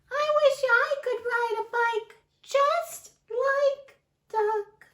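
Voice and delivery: very high, slow tiny voice; wistful mouse. Acted. Mouse envying Duck, very high and slow. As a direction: sigh it like a longing little mouse. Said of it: "we have to use the voices".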